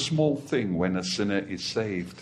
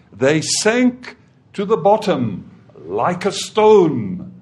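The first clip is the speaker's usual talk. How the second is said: full-force preaching; 4 semitones higher, 6 dB louder than usual. Acted. Preacher reads Exodus to the congregation, loud and ringing. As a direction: proclaim it to the whole congregation.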